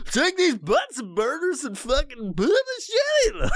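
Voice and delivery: very high, slow goofy scientist voice; squealing dorky presenter. Retold or imitated. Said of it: "dorky scientist".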